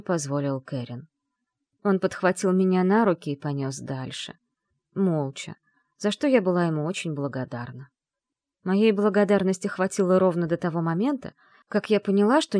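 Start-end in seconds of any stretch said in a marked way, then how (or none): none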